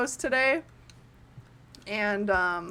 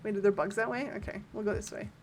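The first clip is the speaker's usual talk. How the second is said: ordinary talk, nothing marked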